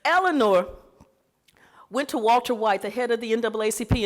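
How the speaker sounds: ordinary delivery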